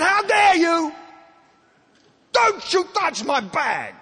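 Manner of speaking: very high, loud indignation; drawn-out, over-the-top acted fury; outraged shout. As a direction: imitate a furious husband yelling. Husband confronting the old man.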